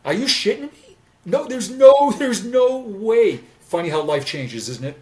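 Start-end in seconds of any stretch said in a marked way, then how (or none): 0.0-1.0 much higher mock disbelief; high, startled "are you shitting me"; incredulous. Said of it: "I would have said". 1.2-3.4 high, loud, drawn-out denial; slow and loud, flatly refusing; emphatic no. Real, not acted. Emphatic denial of the past self.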